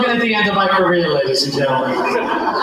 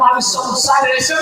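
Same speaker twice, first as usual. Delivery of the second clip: ordinary delivery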